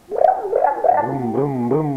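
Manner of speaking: high revving brum brum brum; motorbike engine sound. Shown as a demonstration. Mother imitates a motorbike for her son, high revving engine. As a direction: rev it up like a motorbike. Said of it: "Suara motor?".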